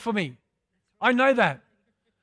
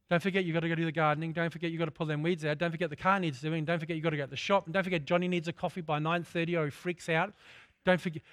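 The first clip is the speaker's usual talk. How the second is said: fast, softer stream of reminders; nagging inner voice; 5 semitones lower, 8 dB quieter, much faster than usual. Retold or imitated. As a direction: rattle off the to-do list quickly. Nagging thoughts bombarding the head.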